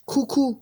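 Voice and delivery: high birdlike coo-coo; a light imitated bird call; cuckoo call. Shown as a demonstration. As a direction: sing it like a cuckoo.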